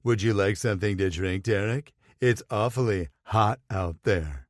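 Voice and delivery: lightly voiced mock husky flirt, eyelashes batting; mild parody of the flirty offer; mock husky imitation. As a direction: tease him by aping his flirting, a hint of husk. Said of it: "infused as much husk into his voice".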